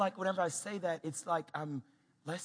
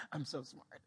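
smug; 5 semitones lower, 11 dB quieter than usual. Acted. Dad smug at toddler's easy question.

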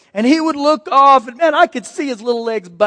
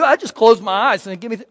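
normal talking voice

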